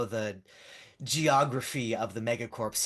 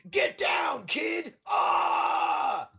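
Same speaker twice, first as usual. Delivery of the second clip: high-pitched, hammed-up action-hero yell; bad hero impersonation; 5 dB louder, much slower than usual. Fans mimic the hero's rescue, overdone impression shouting. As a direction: shout it as a badly imitated gunslinger. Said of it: "doing bad you impersonations".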